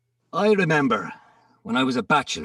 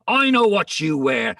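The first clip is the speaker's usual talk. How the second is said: usual speaking voice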